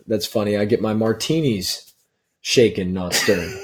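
low, hushed, smooth Bond line; a hint of a suave spy, murmured; lightly voiced James Bond. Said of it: "He says".

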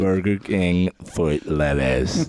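low, slow, droning narrator; deep, stretched-out deadpan narration; Chills. Retold or imitated. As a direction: parody a YouTube countdown narrator. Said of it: "it's like Chills".

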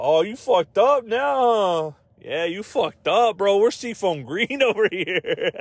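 mock gangster taunt. Gang member mocking magenta rival, slow high taunt. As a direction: gloat slowly like a cartoon thug.

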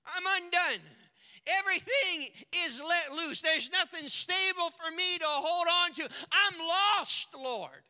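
desperate wail of a lost prophet; crying out. Acted. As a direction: cry out as a broken man before God. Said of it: "Isaiah cries out".

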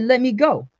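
loud, high demand to be released; struggling demand. A narrator's reading. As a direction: burst out as if held back. Acted struggle while held back.

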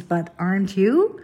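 normal talking voice

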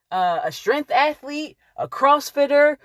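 ordinary talk, nothing marked

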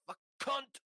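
high, loud barked attack command; mock attack command. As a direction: shout the command at the dogs. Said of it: "whatever the German word is".

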